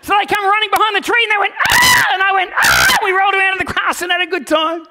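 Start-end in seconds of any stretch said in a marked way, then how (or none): 1.7-2.1 very high, loud squeal; kids' shriek. Retold or imitated. Kids leap at dad. 2.5-3.0 loud, much lower roar; mock scared roar. Dad roars back.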